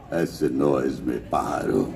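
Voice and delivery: put-on very low, slow, quiet Russian-accented villain; Iván Vanko's Russian accent. Quoting the villain about his bird, Russian accent. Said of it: "por eso hablaba así".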